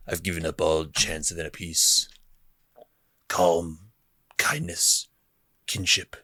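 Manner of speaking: a hint of a brooding spymaster; lightly voiced Luthen. Fan reciting Luthen's monologue, soft and grave. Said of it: "It's the Luthen monologue".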